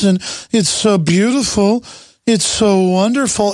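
lightly voiced gushing liberal; mock the sugary praise they use; a hint of gushing delight; mocking their talk. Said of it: "and they talk like this".

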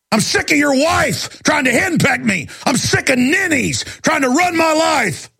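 high, heated complaint building; fed up and railing at everyone; angry rant. Real, not acted. Host railing at pushy people, real angry high rant. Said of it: "and I'm angry".

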